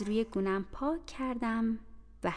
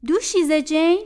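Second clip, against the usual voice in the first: higher, louder call of Jane's name; 7 semitones higher, 12 dB louder than usual. Acted. Bessie calling Jane through the locked door, loud call.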